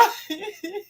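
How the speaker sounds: high breathy giggle; soft high laugh. Real, not acted. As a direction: giggle after the outburst. Reacting to a viewer's comment.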